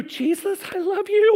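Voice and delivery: high, mock-sweet singsong; coaxing wake-up call. Disciple gently waking Jesus, high mock-sweet coaxing. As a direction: coo it up high, hammed-up.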